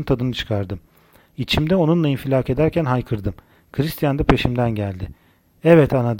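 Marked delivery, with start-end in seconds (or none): 5.6-6.2 louder, understated narrated exclamation; restrained cry out. A narrator's reading. Lover calls out to the narrator, a restrained cry. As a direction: raise the voice, stopping short of a shout. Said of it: "diye haykırdı".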